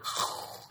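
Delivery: noisy whoosh of pouring rain; imitated rain. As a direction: make the sound of a sudden downpour. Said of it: "and then you hear".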